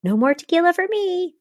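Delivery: high, light dismissal; fake-cheerful excuse. Podcaster imitating Miranda's breezy excuse, high. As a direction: brush it off brightly. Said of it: "not addressing everything".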